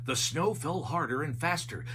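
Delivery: ordinary delivery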